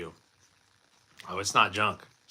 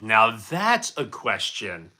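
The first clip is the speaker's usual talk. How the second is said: slow, drawn-out mock-grand delivery; stretched-out showman take on now that's a question; mock announcer; 3 semitones lower, much slower than usual. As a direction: savour it like a game show host. Mock-grand reply to a cheeky chat question.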